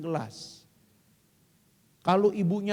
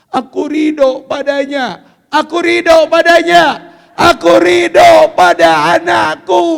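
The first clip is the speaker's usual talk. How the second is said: building cry; 8 semitones higher, 18 dB louder than usual. Acted. Mother repeating her vow of acceptance, swelling.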